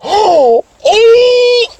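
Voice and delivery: high, drawn-out "oh"; hammed-up high gasp of discovery; mock shocked realization. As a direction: squeal as the penny drops. Acts kids getting the joke later.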